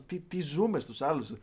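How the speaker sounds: usual speaking voice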